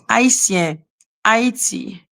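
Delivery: Haitien, Haiti, slowly, with silent H; French pronunciation. Shown as a demonstration. Teaching how French says it, French pronunciation. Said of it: "In French, we say".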